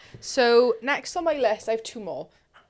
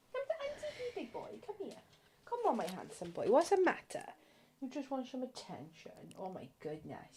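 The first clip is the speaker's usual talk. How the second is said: much softer, sing-song pet talk; cooing at the dog; 11 dB quieter, faster than usual. Real, not acted.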